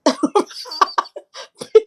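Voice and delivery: high, delighted laughter. Real, not acted. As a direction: burst out laughing. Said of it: "That's why I was laughing like that".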